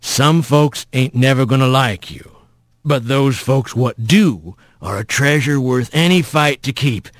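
low, measured fatherly counsel; folksy rough voice. Acted. Mysterious voice counsels fallen Rat, low and fatherly. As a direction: advise him low and steady, like an elder.

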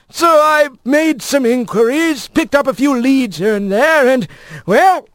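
high, excitable mail-carrier bird; chatty old postbird. Acted. Old postbird tells Rat of the long search, high and chatty.

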